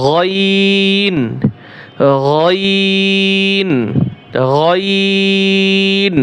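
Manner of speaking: গইন held very long; drawn-out letter. Shown as a demonstration. Teacher drilling students on a long গইন. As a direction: stretch গইন slowly for three alif beats. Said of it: "গইন, এখানেও তিন আলিফ টান হবে।".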